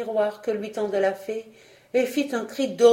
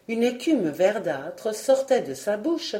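ordinary delivery, nothing marked

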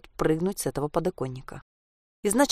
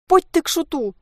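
high, loud exasperated moan; groaning grandmother imitation; 10 semitones higher, 5 dB louder than usual. A narrator's reading. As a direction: groan like a fed-up granny. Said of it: "простонала Саша, подражая своей бабушке". (простонать, подражая раздражённой бабушке)

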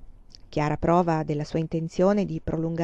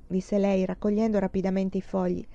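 ordinary delivery

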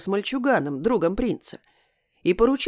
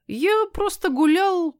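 evasive; 11 semitones higher, slower than usual. A narrator's reading. Cat's evasive excuse.

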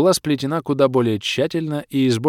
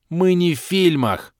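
higher, sharp slow rebuke; snapping; 8 semitones higher, 4 dB louder, slower than usual. A narrator's reading. Hero snaps at the girl about films, irritated. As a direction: cut her off hard.

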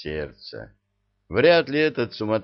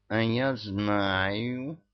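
very slow, stretched cat voice; drawn-out meow; much slower than usual. A narrator's reading. Cat Murzik announcing he knows, drawling meow. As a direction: draw the words out like a meowing cat. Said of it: "протяжно мяукнул Мурзик".

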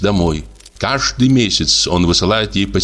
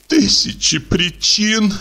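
high, slow sobbing echo; 12 semitones higher, slower than usual. A narrator's reading. Olga echoes the painter through sobs, high and slow. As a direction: sob his words back at him, voice pitched up. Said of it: "всхлипнула Ольга Ивановна".

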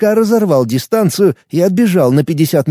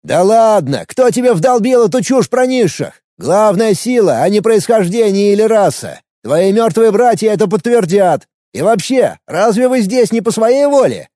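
mocking retort; 7 semitones higher than usual. A narrator's reading. Alex taunts.

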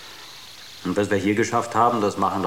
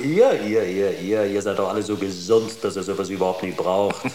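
ordinary talk, nothing marked

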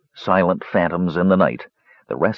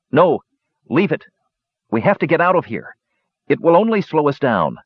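firm, hurried warning; lightly voiced urgent half-elf; urgent Tanis; 6 semitones higher than usual. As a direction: push him to move now, higher and quick.

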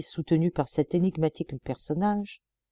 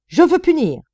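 much higher, loud outburst; enraged cry; furious insistence; 10 semitones higher, 8 dB louder than usual. A narrator's reading. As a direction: demand punishment. Director insists on punishing.

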